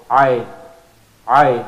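ayn squeezed from the middle of the throat; throaty ayn. Shown as a demonstration. Teacher drilling the ayn sound. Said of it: "From the middle of the throat".

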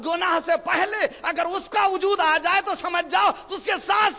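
high-pitched, urgent sermon delivery; full-voiced pulpit exhortation; impassioned preaching. Real, not acted. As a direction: preach it high, driving to a call for blessings. Preaches at rising force.

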